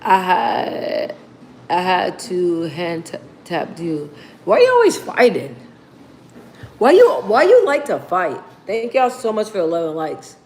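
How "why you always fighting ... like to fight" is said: lightly mimicked pestering kid; higher, mock-childish questioning; a hint of a nosy kid; nagging little kid.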